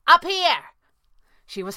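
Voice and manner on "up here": high, loud holler; ringing shout. A narrator's reading. Kit calling from up the statue, ringing shout. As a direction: call down to get attention. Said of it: "Kit's voice rang out".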